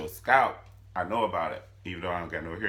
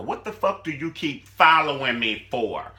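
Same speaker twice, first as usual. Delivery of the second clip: loud, higher snap at her shadow; Quita snapping; 5 semitones higher, 9 dB louder than usual. Retold or imitated. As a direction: bark it like a threat. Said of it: "this bitch would stab her shadow".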